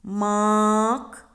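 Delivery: "Мак" with a long, loud а; slow sounding-out of "Мак", vowel sustained; drawn-out vowel. Shown as a demonstration. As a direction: stretch the а in "Мак" on a held note. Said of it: "Шукаємо звук".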